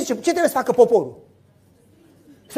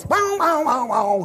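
engine noise; 2 semitones higher, slower than usual. Retold or imitated. Imitating motorcycle engine.

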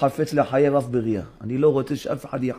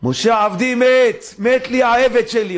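grieving God; 9 semitones higher, 4 dB louder, slower than usual. Acted. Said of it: "אומר השם".

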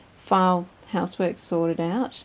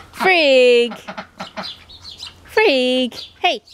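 calling out; 4 semitones higher, 6 dB louder, much slower than usual. Real, not acted. Calling out to her duck.

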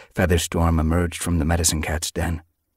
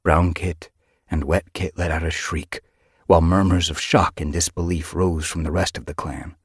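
plain reading voice

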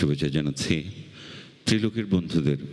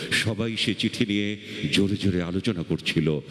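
higher, urgent, building recital; impassioned poem recitation; 7 semitones higher than usual. Acted. Reciter recalls news of language martyrs shot, impassioned recitation. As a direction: drive the verse onward, pitch climbing towards the end.

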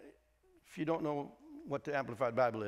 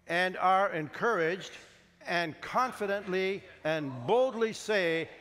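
slow, bold declaration building louder and higher; leading congregational reading; 4 semitones higher, 5 dB louder, slower than usual. Acted. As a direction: lead the room, rising to a ringing finish. Leads congregation reading aloud.

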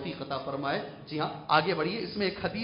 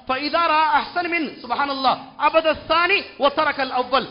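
raised, ringing preaching voice; declaimed Arabic citation; 8 semitones higher, 9 dB louder than usual. Acted. Preacher quoting commentary to the congregation, declaimed Arabic citation. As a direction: proclaim the Arabic text to the room.

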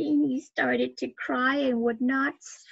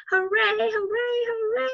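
high, bright cheer rising each time; 9 semitones higher, 3 dB louder than usual. Acted. Reader cheering for Owen's new handkerchiefs, excited. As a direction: cheer it like a happy kid.

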